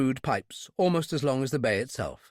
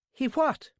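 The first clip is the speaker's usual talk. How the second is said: high, incredulous Irish question; 12 semitones higher than usual. A narrator's reading. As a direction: react in disbelief with an Irish accent. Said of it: "as Irish as a sprig of shamrock".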